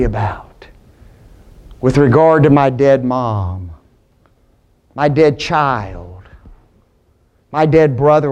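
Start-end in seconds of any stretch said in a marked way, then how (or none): none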